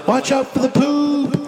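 high, slow, sing-out holler; drawn-out warning call. Pretend. Warning his friend down the hole, high drawn-out call. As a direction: stretch the warning out like a far-off call. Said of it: "all of a sudden I'm like".

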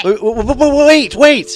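stammering plea. Acted. Caller begging agent not to hang up.

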